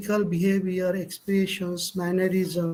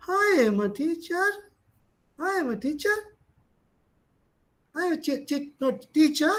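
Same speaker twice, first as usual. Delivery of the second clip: higher, hesitant, stammering teacher; unsure; 6 semitones higher than usual. Shown as a demonstration. Teacher demonstrating a nervous, unconfident tone, higher pitched. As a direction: say "I am a teacher" high and unsure, breaking off. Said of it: "He doesn't have confidence on himself".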